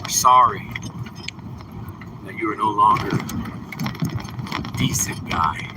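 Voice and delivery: hammed-up funeral condolence; mock solemn. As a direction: deliver it like a eulogy. Mock eulogy.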